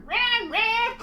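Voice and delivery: high, loud put-on Jamaican voice, laughing; laughing fake Jamaican cry; mock Jamaican accent. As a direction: squeal it in a hammed-up Jamaican accent. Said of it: "how they ask that girl to be Jamaican".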